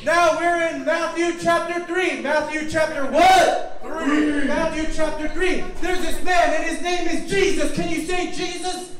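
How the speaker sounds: high, loud, eager storytelling; excited rallying. Real, not acted. Rousing children with Bible story.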